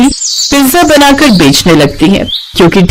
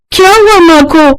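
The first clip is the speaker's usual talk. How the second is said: alarmed; 8 semitones higher than usual. Acted. Sister alarmed about mother.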